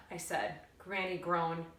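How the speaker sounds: usual speaking voice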